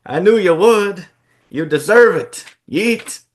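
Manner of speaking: high, loud, gushing praise; hyped tag partner, high and loud; overjoyed Jay. Acted.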